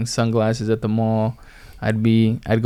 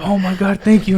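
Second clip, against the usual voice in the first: grateful excitement; 10 semitones higher, 4 dB louder than usual. Retold or imitated. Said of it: "and I was like".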